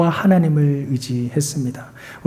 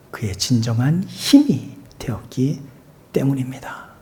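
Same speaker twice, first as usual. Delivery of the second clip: hushed whisper to close the point; whispered ending; 8 dB quieter than usual. Real, not acted. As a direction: let the word fall into a whisper. Whispered sermon line end.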